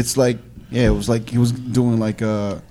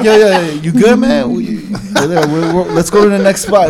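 laughing; 8 semitones higher, 9 dB louder than usual. Retold or imitated. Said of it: "I'm like".

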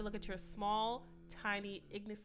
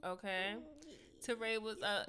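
ordinary talk

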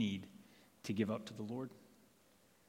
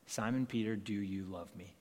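soft, quiet and gentle, like a loving master; tender question; 3 semitones lower, 6 dB quieter than usual. Acted. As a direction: ask it softly, almost murmured. Jesus gently questions Peter.

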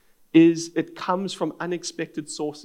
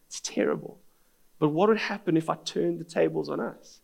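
ordinary talk

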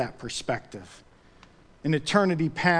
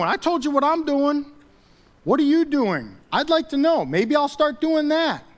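full-force preaching; 8 semitones higher than usual. Real, not acted. Preacher challenging idle churchgoers to do something, forceful preaching. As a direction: challenge the congregation with raised pitch and force.

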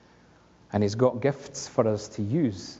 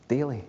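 "Daily." set apart alone, falling; single-word emphasis on "Daily."; stressed word. Shown as a demonstration. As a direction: lean on "Daily." as its own sentence. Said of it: "Let's underline that word".

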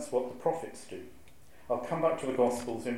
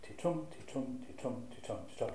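soft "Ti-tum" repeated, short "Ti" and long stressed "tum"; iambic rhythm; 8 dB quieter than usual. Shown as a demonstration. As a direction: tap the heartbeat rhythm quietly.

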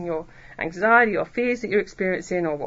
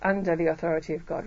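ordinary talk

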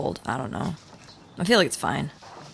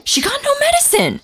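cracking high with outrage; angry outburst. Acted. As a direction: voice jumps way up in anger. Siblings arguing over dead sister.

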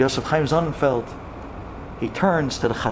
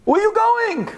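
high, stretched-out cry; acted, high-pitched desperate call; screaming. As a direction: scream after someone running away. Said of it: "she starts screaming".

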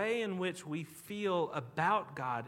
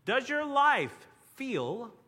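dramatic slow; 7 semitones higher, 6 dB louder, much slower than usual. Acted. Dramatic slow question opening sermon.

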